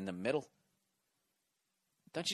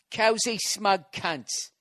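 slow, high English accent, each word drawn out; 7 semitones higher, 5 dB louder, much slower than usual. Shown as a demonstration. Host doing the English accent he asked a fan for, slow and high. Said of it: "in the English accent".

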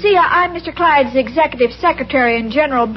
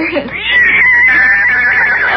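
high squealing laugh; 3 dB louder than usual. Acted. Irma squeals over horses together.

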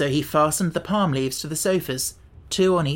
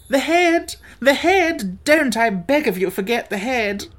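very high, loud, urgent pleading; excited cry; 9 semitones higher, 5 dB louder than usual. A narrator's reading. Wogglebug begging Tip not to forget, excited cry. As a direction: start piercingly high and loud, ease slightly into begging. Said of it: "cried the Wogglebug excitedly".

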